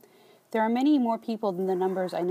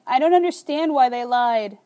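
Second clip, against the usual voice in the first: higher, louder tearful plea; quivering voice; 5 semitones higher, 8 dB louder than usual. A narrator's reading. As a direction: let the voice shake as you ask. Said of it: "My voice quivers as I say it".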